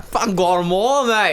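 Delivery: high, hammed-up Australian accent; playful mock Australian. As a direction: put on a broad, cheeky Aussie accent. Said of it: "I love an Australian accent".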